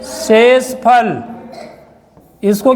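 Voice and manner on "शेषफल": शेषफल stretched very slowly, syllable by syllable; drawn-out term. Shown as a demonstration. Teacher to pupils, naming the term: शेषफल very slow. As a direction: spell out शेषफल slowly for the class. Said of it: "teacher drawing out term".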